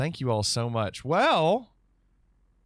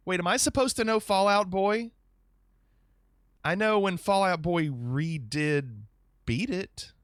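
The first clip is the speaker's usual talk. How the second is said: ordinary talk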